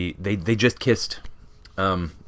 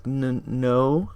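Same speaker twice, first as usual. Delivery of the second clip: mock sad; 6 semitones higher than usual. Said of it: "sad little look".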